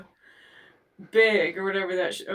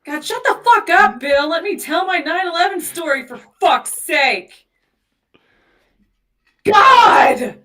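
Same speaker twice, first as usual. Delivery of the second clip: loud exasperated yelling; mock outburst; 7 semitones higher, 11 dB louder than usual. Real, not acted. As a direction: shout him down, fed up. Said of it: "I'm sorry".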